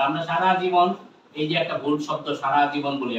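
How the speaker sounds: normal talking voice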